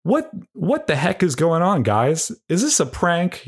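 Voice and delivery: a proper fellow baffled at being trapped; upright gentleman. Retold or imitated. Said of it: "that's how he talks".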